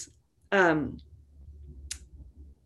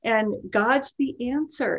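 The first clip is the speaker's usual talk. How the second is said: normal talking voice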